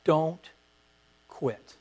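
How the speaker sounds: ordinary delivery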